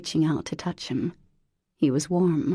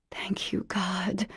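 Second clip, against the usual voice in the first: unvoiced whispered thanks; 2 semitones higher, 6 dB quieter, slower than usual. Mother thanks God beside her sleeping son, whispered. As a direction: breathe it as a prayer.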